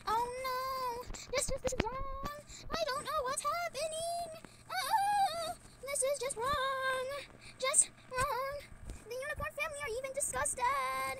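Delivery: high, wailing oh no, near tears; whiny distress. Real, not acted. Upset at the ugly character, high whiny wailing. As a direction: whimper like it's all ruined.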